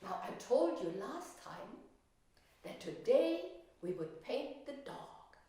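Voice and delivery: ordinary talk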